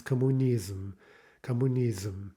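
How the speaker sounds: "Kommunism" in Russian with a slight vowel before M; extra final syllable. Shown as a demonstration. Teacher showing extra syllable at the end, Russian M. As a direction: let an extra syllable pop up ending "Kommunism". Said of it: "kind of extra syllable".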